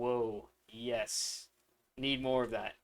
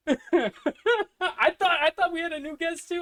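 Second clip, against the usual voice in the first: high, laughing through the words; can barely stop laughing; 9 dB louder, slower than usual. Real, not acted. Laughing about the hacked sound.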